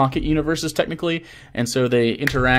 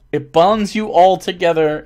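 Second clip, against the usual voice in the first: ordinary talk, nothing marked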